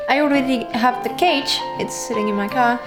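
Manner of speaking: ordinary talk, nothing marked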